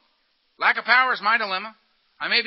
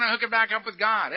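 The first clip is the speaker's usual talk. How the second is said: usual speaking voice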